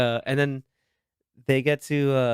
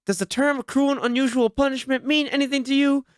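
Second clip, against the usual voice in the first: high, pointed mock coach question; sarcastic coach voice, pitched up; stern coach; 11 semitones higher than usual. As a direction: needle him like a tough coach. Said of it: "and he's just like".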